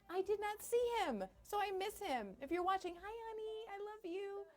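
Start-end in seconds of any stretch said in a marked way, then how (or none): 2.9-4.5 tender greeting. Real, not acted. Greeting her husband on air.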